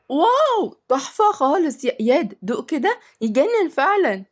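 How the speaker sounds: put-on high-pitched wowed child voice; very admiring. A narrator's reading. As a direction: rave about it, starting on a soaring exclamation. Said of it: "وقال باعجاب جامد".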